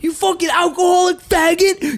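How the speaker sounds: very high, loud ranting shout; imitated furious yelling. Guy screaming at his drunk clone friend, furious yell. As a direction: berate him like he's dragging a drunk out by the neck. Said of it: "And he's like".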